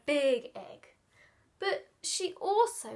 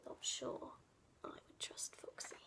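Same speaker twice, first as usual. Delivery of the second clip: whispered aside; 17 dB quieter than usual. Real, not acted. Conspiratorial aside about Foxy.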